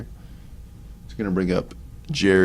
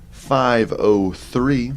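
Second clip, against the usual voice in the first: slow, higher and louder on five oh three; emphatic stat read; 5 semitones higher, 5 dB louder, much slower than usual. Real, not acted. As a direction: spell out five oh three slowly, digit by digit, with weight.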